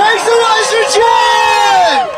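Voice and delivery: high-pitched hype shout, drawn out; big rally emcee cheer call; rousing shout. Real, not acted. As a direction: whip up the crowd, stretching the last word. Rally host whipping up crowd.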